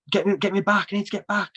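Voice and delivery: high pleading mock shout, pitched well up; retold shouting. Falling through the dark, begging to get back, high retold shout. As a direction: call out to be brought back, half-laughing at the memory. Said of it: "I was almost shouting".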